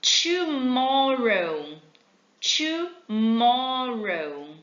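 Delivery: tomorrow drawn out very slowly; slow model pronunciation. Shown as a demonstration. Teacher modelling tomorrow very slowly for students. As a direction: stretch tomorrow syllable by syllable. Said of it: "Próxima palavra".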